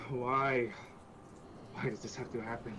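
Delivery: acted despair, trailing down; frustrated despair. Iku runs fingers through her hair, despairing and frustrated. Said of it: "she just seems absolutely frustrated".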